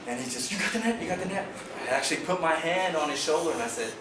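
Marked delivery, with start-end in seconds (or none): 0.5-1.4 anxious asking. Retold or imitated. Anxious partner asking for net.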